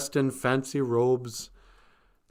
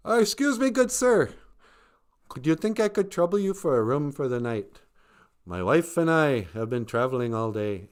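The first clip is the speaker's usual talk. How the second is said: overly polite Joseph. Retold or imitated. Joseph asks innkeeper, politely.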